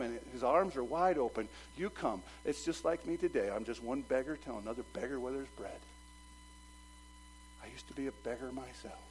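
ordinary talk, nothing marked

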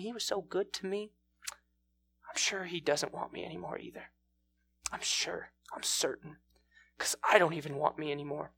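very soft, halting, dejected musing; acted despair. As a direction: think aloud in hushed regret, with long pauses. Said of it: "she feels lower than she's ever felt".

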